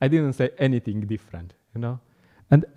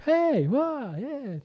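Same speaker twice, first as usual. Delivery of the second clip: much higher, excited hey wow; enthusiastic greeting; 13 semitones higher, 6 dB quieter, much slower than usual. Retold or imitated. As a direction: burst out with joyful greeting. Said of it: "Just like".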